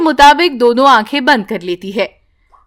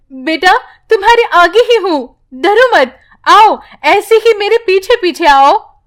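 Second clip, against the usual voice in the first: higher, urgent but calming mother; mother calling to her frightened daughter; reassuring mother calling; 7 semitones higher than usual. Acted. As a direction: keep your child following you.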